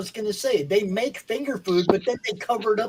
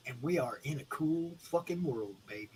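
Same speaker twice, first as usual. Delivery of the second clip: usual speaking voice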